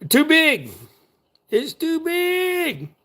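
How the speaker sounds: put-on high, slow little-kid whine; dad mimics his son's complaint in a squeaky child voice; imitating Gregor. Dad imitates son griping the hooks are too big, high childlike whine. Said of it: "That's how he says it".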